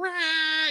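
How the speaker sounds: high mock cat yowl. Imitating the cat's yowl.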